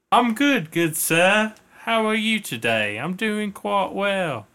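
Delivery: high-pitched put-on British accent; mock-polite British accent. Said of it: "you're speaking with a British accent".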